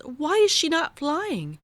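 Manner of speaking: high, worried question; a kind butterfly checking on her; concerned butterfly. Acted. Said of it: "asked Beatrice".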